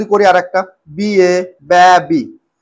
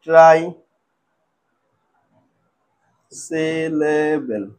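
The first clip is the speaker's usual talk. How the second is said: "ট্রাইসিলেবেল" extremely slow, with a long gap after "try"; lower, drawn-out "ট্রাইসিলেবেল", piece by piece; broken into syllables; 4 semitones lower, 4 dB quieter, much slower than usual. Shown as a demonstration. Teacher writing out the term for students, "ট্রাইসিলেবেল" slowly broken up. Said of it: "ছোট ছোট টুকরো করে ভাঙবো".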